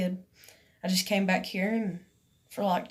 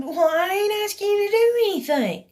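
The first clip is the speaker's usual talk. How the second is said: much higher, louder retold retort; heated protest; 12 semitones higher, 6 dB louder than usual. Arguing back while being threatened with police, heated. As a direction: argue back in a raised, high voice.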